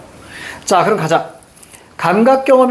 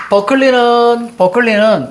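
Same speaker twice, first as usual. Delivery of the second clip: higher, held-out ending on the name; drawn-out recitation; 5 semitones higher, slower than usual. Real, not acted. Teacher drilling Berkeley's view into students, drawn-out recitation. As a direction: stretch the subject out for the class.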